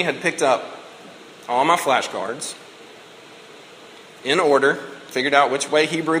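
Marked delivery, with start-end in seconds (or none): none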